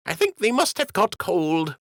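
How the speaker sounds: put-on high, playful old dockhand voice; high-voiced dockhand cracking a joke; joking dockhand. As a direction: tease the captain, pitched high.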